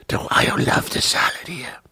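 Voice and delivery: rapturous whisper. Retold or imitated. Waitress Susan swooning over salad, whispered. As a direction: whisper it like a confession of love.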